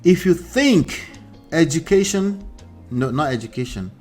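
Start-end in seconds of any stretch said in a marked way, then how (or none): none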